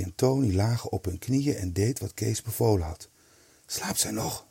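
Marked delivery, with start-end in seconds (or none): 3.7-4.5 hushed, very soft question; barely audible. A narrator's reading. Eddy asking whether the sleeping woman is still out, barely audible. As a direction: ask it almost inaudibly, softly. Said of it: "vroeg Eddy bijna onhoorbaar".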